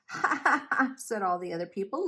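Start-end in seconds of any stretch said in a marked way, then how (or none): 0.1-0.9 high, loud, staccato ha-ha jeer; mocking laughter. A narrator's reading. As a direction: act out a crowd laughing at Noah. Other people mock Noah.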